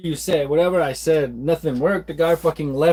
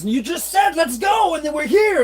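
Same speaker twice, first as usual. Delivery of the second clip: exasperated shout; 10 semitones higher than usual. Retold or imitated. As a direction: shout it at him in disbelief. Arguing with forgetful father.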